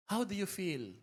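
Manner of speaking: softly modelled caring question; quiet, lightly acted check-in; gentle question. Shown as a demonstration. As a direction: ask it softly, as if checking on someone. Models the right question to ask.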